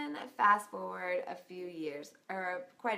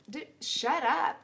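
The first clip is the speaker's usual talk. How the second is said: exasperated; 7 semitones higher, 6 dB louder, slower than usual. Real, not acted. Exasperated retort to counselor.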